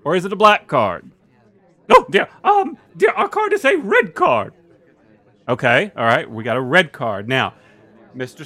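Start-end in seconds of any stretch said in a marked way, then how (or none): 1.9-4.5 put-on much higher fussy old lady. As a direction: squeak it out like a prim old woman. Missus Potter Butter Churn voice.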